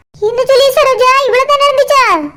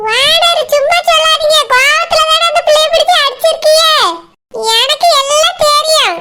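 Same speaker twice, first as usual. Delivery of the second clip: high, pointed village woman's accusing voice; put-on fish seller voice; 5 semitones higher than usual. Fish seller accusing the owner, high and pointed. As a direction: jab at him as the nosy fish seller.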